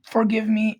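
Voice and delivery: ordinary delivery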